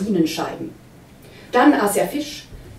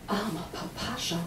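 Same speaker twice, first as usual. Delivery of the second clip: much softer, a stunned near-whisper; dismayed whisper; 11 dB quieter than usual. A narrator's reading. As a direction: breathe it out in dismay. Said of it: "flüsterte die Wirtin bestürzt".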